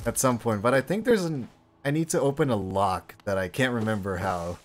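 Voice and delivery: usual speaking voice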